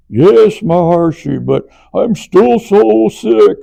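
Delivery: slow, high, loud moaning whine; whiny complaint. Retold or imitated. Swedish course member moaning to Maharishi, slow high loud whine. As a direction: drag out every word like a sick martyr.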